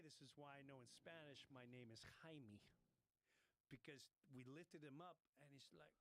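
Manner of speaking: normal talking voice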